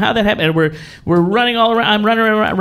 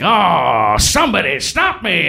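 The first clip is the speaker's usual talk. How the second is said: Grinch line; 2 semitones higher, slower than usual. Retold or imitated. Grinch actor's hammy line.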